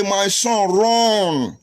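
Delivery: high, half-sung warning; sing-song the father's order to run; imitating his father.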